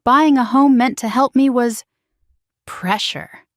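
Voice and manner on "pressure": quiet, disbelieving "pressure."; dry incredulous echo. A narrator's reading.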